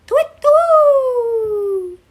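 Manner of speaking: high, loud hoot with a long falling tail; owl hoot. Acted. Child pretending to be an owl, hooting. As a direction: hoot like an owl, stretching the second note.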